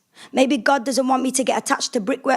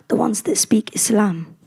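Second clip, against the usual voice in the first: whisper. Acted. Sneering aside in poem.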